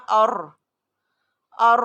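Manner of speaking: ار said heavy and held; heavy Quranic ار; thick ar. Shown as a demonstration. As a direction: thicken ار like a drill. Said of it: "اس کو بھی ہم موٹا پڑھیں گے".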